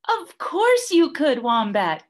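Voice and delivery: high, emphatic reassurance; of course, dear; bossy Emu. A narrator's reading.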